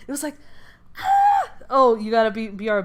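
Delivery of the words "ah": screeching shriek, far higher and louder; monster screech. Retold or imitated. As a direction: let out a piercing monster screech, very high. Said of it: "let out this deafening screech".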